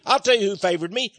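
ordinary talk, nothing marked

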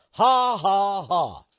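fake, spelled-out ha-ha laugh; mock big laugh. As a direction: imitate a politician's phony laugh. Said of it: "Yeah, big laugh".

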